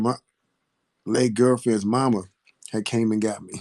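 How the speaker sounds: normal talking voice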